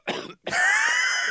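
hacking cough mid-sentence; chesty cough. Real, not acted. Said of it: "You okay with that cough".

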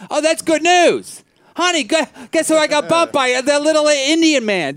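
mock cheerful. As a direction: gush with forced delight. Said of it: "I'm supposed to be like".

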